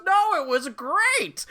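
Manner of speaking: put-on high, slow old lady; cheerful old woman, drawn out. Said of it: "And she goes".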